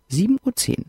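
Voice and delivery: ordinary delivery, nothing marked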